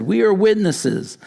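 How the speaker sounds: high, loud ringing declaration; proclaiming elders. Retold or imitated. Elders at the gate declare to Boaz, loud proclamation. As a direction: cry it out as the whole gate.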